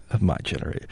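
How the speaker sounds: low, soft mock-millennial fry; mock vocal fry. Teasing host about reading a millennial's question, vocal fry. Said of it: "More vocal fry".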